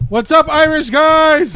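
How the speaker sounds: loud, high, drawn-out holler; big slow shouted greeting; hearty shout-out. Real, not acted. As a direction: call out to the fans across the world. Calling out to Irish listeners.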